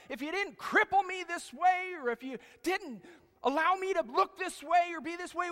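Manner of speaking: loud, high, desperate shout; desperate cry to God. Retold or imitated. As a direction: cry out for help at the top of the voice. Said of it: "No, I don't think he prayed like that. He said".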